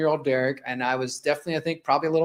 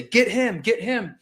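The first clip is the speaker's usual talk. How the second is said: higher-pitched imitation of an urgent command; retold cop's order; 6 semitones higher, slower than usual. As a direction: act out cops ordering a grab, half-voiced shout. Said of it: "eventually the cops say".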